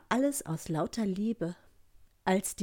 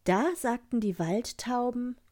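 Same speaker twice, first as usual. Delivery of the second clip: ordinary delivery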